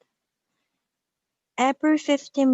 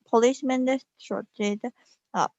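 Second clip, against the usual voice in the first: ordinary delivery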